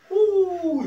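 high, loud teasing ooh; high mocking coo. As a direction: tease with a squealed ooh. Teasing Lamplighter fans.

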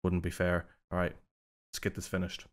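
ordinary talk, nothing marked

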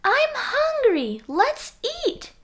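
put-on high, slow whiny kid frog; a high little frog voice, stretching it slowly; 1 Froggy voice. A narrator's reading. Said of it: "Froggy said".

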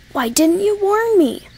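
high, indignant protest; reproachful. A narrator's reading. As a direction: demand an explanation, pitch climbing high. Injured engine confronts the other.

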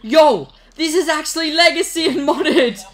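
gleeful celebration. Real, not acted. Gloating to his brother after the trade, loud excited hype. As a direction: crow over the win, barely holding back laughter.

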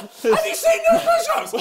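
high words breaking into laughter; can barely get it out for laughing. Real, not acted. Laughing at friend's form.

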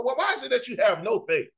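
ordinary delivery

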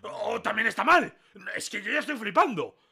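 high, loud indignation; incredulous protest. Real, not acted. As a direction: argue back loudly in disbelief. Protests being marked wrong again.

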